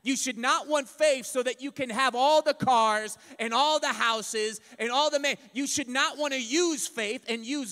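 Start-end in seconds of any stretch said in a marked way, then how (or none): none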